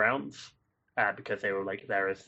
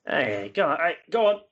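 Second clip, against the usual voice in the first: gruff NPC voice; 6 semitones higher, 4 dB louder than usual. Acted. NPC shooing.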